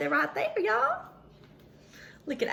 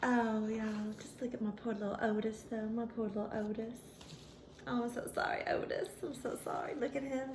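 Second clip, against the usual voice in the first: softer, fast sorry murmur; soft pity; 4 semitones lower, 11 dB quieter, faster than usual. A narrator's reading. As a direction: coo sorry to poor Otis. Pitying poor Otis.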